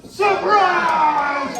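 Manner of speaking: long stretched high shout to lead the room; crowd shout. Shown as a demonstration. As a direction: yell it out slow and big with the crowd. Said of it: "Everybody together".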